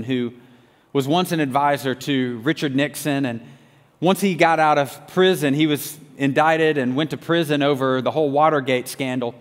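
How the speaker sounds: ordinary talk, nothing marked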